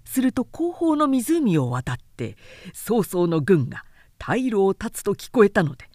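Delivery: plain reading voice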